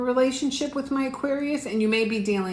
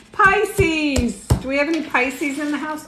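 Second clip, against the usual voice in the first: calling out; 7 semitones higher, 4 dB louder than usual. Real, not acted. Calling out to Pisces viewers.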